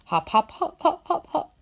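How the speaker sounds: high sobbing syllables. Acted. Fox sobbing after departing Brownie, sobbing. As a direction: blubber each word out. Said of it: "sobbed the fox".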